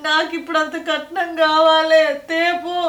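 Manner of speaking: loud, tearful cry as she repeats the demand. Acted. Wife to her mother, repeating the husband's dowry demand, loud and crying. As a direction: wail it through tears.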